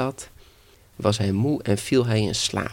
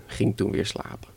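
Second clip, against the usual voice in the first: ordinary delivery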